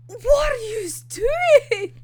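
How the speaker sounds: high, drawn-out incredulous question; slow wail of disbelief; exasperated outburst. Retold or imitated. Reader reacting to manga characters guilt-tripping, exasperated.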